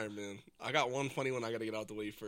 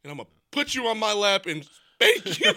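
loud, raised wrestler declaration; imitated unhinged promo; 6 semitones higher, 9 dB louder, slower than usual. Fan re-enacting Kurt Angle's promo, hammed-up tweaking. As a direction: build louder and slower toward the punchline. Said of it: "he was tweaking".

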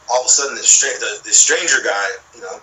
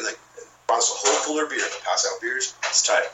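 ordinary talk

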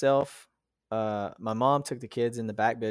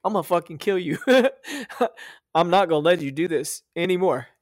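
retold defiance; 6 semitones higher, 5 dB louder, faster than usual. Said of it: "I was like".

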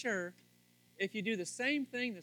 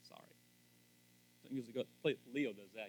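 normal talking voice

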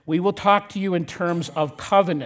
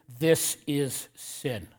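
THIS IS SIN slow and softer, word by word; quietly emphatic; 3 semitones lower, 6 dB quieter, much slower than usual. Retold or imitated. Pastor quoting God in Scripture, "This is sin" slow and emphatic. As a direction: quote "This is sin" quietly, weighting every word. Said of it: "God emphatically says in Scripture".

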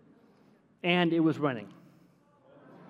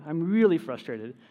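ordinary talk, nothing marked

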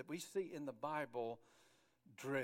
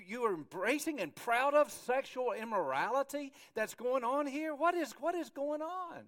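high, incredulous scolding, building louder; appalled rebuke; 7 semitones higher, 6 dB louder than usual. Acted. Apostle hearing of lawsuits and immorality in church, appalled. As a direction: build from disbelief to loud outrage. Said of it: "He's going".

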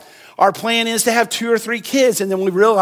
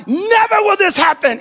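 high-pitched mock freak-out; hammed-up outburst of protest; 10 semitones higher, 3 dB louder than usual. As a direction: cry it out high, in acted alarm. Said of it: "we really see Peter freak out".

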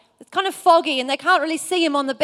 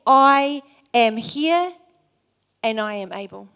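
God's promise, slow; 3 semitones lower than usual. Acted. Preacher voicing God's promise.

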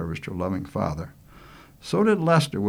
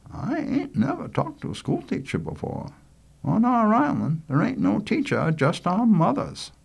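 put-on higher-pitched boy voice; high, artless country lad; frank island boy; 13 semitones higher than usual. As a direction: explain island life to a teacher as a kid would. Richie talks to teacher.

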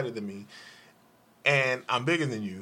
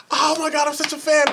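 gushing fan; 11 semitones higher, 5 dB louder than usual. Retold or imitated. Imitating an excited fan meeting Chris Mack, high gushing squeal. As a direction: gush like a starstruck fan. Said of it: "she said".

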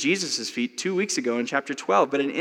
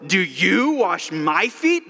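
protesting; 4 semitones higher, slower than usual. Retold or imitated. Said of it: "He protests".

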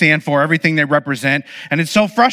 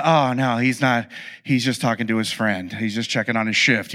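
deflated letdown; 7 semitones lower, 5 dB quieter than usual. Retold or imitated. Hope dashed, worker ignores ride.

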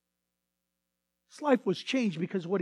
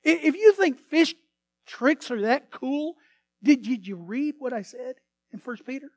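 pressing Peter; 3 semitones higher, 7 dB louder than usual. Acted. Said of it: "Peter would say".

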